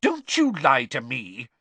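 cross mother, every word drawn out; angry scolding. A narrator's reading. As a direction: warn a fibbing child sternly. Mother scolding her lying son.

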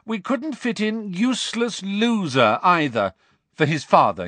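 high, needling jeer; taunting call. A narrator's reading. Malfoy goads Fred and George about their father, high taunting call. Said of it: "Malfoy called".

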